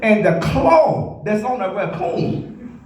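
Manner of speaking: ordinary talk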